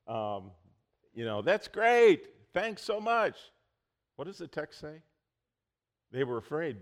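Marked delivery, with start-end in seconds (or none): 1.4-3.5 high, loud gushing thanks. Pretend. Imagined disciple thanking Jesus warmly, high and loud. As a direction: gush your thanks like a relieved friend.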